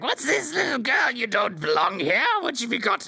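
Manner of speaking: high, squeaky rat creature; sneering high-pitched rat. Acted. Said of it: "The guy's like".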